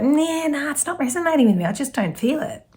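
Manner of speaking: mock sceptic brushing it off; doubting viewer. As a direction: toss it off like an unconvinced listener. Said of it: "Or it could be like".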